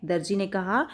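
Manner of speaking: usual speaking voice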